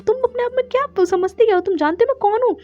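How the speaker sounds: high-pitched mock indignation; retold angry challenge. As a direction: challenge her, acting out his temper. Retold angry quarrel, he confronts her. (ऊँचे सुर में नकली गुस्से भरी ललकार)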